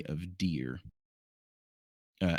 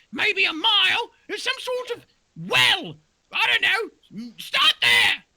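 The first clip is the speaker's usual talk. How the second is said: very high, louder, silly; a shrill cartoon doe; high-pitched doe voice; squeaky deer voice; 8 dB louder than usual. Doe gives directions.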